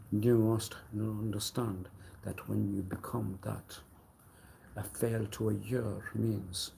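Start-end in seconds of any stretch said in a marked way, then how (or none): none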